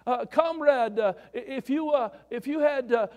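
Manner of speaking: mock Russian comrade telling a joke. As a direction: play both men in the joke, broad and loud. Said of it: "this Russian guy".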